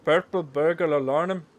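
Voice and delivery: loud, slow mock Scottish accent; the best loud attempt at a Scottish accent. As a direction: roll it out slowly in a hammed-up Scottish accent. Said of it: "I think that was the best one".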